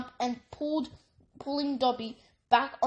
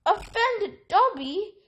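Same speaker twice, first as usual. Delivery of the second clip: choked; 8 semitones higher, 4 dB louder, slower than usual. A narrator's reading. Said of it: "choked the elf".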